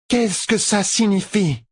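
high, slow imperious question; Tuon demanding answers. A narrator's reading. Empress questions her husband, demanding. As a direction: demand an explanation, high and stretched. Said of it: "lâcha Tuon".

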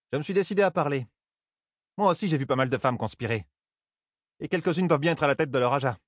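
solide paysanne en confidence. A narrator's reading. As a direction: voice a blunt countrywoman pressing for answers. Reine d'allure, parler de paysanne. (paysanne au parler franc, voix plus aiguë)

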